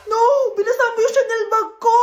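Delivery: gasp, then a high, indignant cry; outraged over the ruined bag; shocked outrage. Acted. Rich girl's bag gets wet. (gulat at galit na reklamo)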